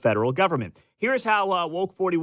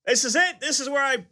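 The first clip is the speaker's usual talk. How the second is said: hammed-up indignant protest, pitched way up; mock outrage; 11 semitones higher than usual. Host mocking a fed-up DOGE supporter, mock outrage. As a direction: play a supporter dramatically quitting in high-pitched fury.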